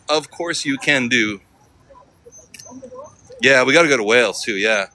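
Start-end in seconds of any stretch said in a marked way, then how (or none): 0.1-1.4 slow, word-by-word "Of course you can do"; slowly deciphered. Shown as a demonstration. Streamer works out what the local said, slow and separated. Spells out the misheard local reply.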